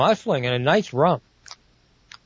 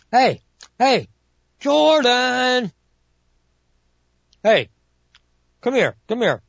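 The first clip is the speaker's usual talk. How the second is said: calling out; 8 semitones higher, slower than usual. Acted. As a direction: shout up to Jordan, come here. Calling to assistant Jordan below.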